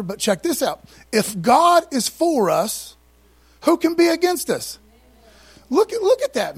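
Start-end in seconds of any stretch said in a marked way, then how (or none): 1.1-4.8 preaching scripture. Acted. 5.7-6.6 excited exclamation. Real, not acted. Preacher exulting over verse.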